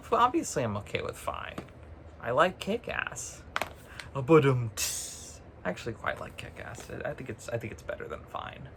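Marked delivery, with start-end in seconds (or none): 0.6-1.6 unvoiced whisper, much softer; hushed, whispered admission; whispered aside. Real, not acted. Quiet confession aside. 4.8-8.7 unvoiced whisper, much softer; hushed, whispered admission; whispered aside. Real, not acted. Hushed confession of liking film.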